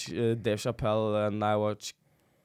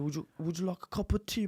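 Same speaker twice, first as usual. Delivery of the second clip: mock British accent; 6 semitones higher, 5 dB quieter than usual. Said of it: "in your mind you speak like a British guy".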